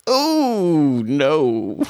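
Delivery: high, drawn-out wail sliding down; dismayed cry. Real, not acted. Player reacts to a bad dice roll, long dismayed cry. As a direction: let the dismay stretch out high.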